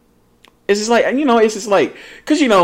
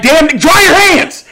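high, raised-voice demand; exasperated outburst; 12 semitones higher, 4 dB louder, slower than usual. Real, not acted. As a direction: exclaim it high, fed up with them. Ranting at people with wet hands.

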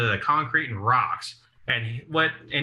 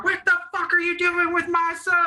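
very high, screechy mock-mom yell; imitating furious mom. Mom gets out of the car at the cop, mock furious. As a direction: play an outraged mother at a very high pitch.

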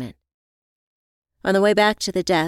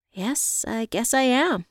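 modest reply with a chuckle after it; light chuckle; 6 semitones higher, slower than usual. A narrator's reading. Evie answering her friend's praise, chuckling modestly. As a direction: agree lightly, ending in a small laugh. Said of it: "I replied with a chuckle".

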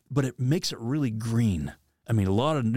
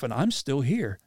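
normal talking voice

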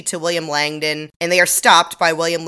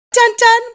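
much higher, louder sung dun dun; hammed-up TV sting; dramatic sting; 8 dB louder than usual. Marking a plot twist, sung dun dun.

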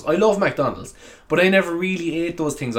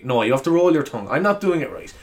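usual speaking voice

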